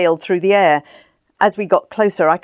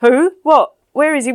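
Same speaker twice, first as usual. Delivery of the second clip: high, loud, jumpy bursts; startled confusion; 9 semitones higher, 6 dB louder than usual. Acted. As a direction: jolt awake and look round. Drunk Danny startled.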